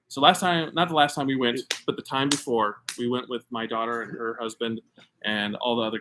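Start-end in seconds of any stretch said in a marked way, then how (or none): none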